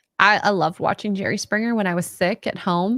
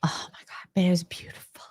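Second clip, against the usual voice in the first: whisper; 7 dB quieter than usual. Real, not acted. Whispered aside about dress.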